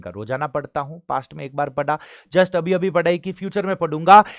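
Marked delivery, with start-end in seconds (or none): 2.3-4.2 loud, higher, pushing the class; energetic classroom push; emphatic teacher. Real, not acted. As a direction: drive the point home loudly.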